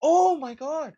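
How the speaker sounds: high, incredulous cry; exasperated cry at the news clip; exasperated outburst. Real, not acted. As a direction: throw it up high in disbelief.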